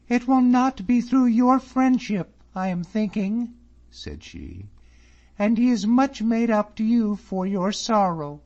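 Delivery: high, loud, cutting; mock him coldly; scornful young lady. A narrator's reading. Miss Drummond scorning David, high and sharp.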